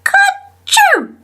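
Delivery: very high, squeaky "kerchoo" sneeze; acted sneeze. A narrator's reading. Peter hiding in the tool shed, sneezing high. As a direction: sneeze it out as a high spoken word. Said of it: "Peter sneezed".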